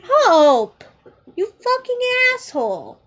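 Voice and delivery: loud, high exasperated yell; shouting at the misbehaving dog. Real, not acted. Yelling at the dog.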